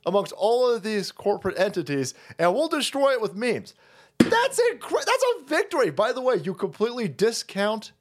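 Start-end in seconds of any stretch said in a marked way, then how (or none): 4.2-5.9 high, excited burst. Real, not acted. Celebrating the meme victory, high excited. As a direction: cheer the win.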